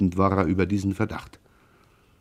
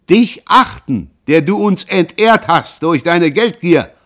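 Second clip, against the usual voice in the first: much louder and higher, slow; furious outburst; 8 semitones higher, 8 dB louder, slower than usual. Acted. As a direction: spit the charge out. Pierre erupts with the accusation.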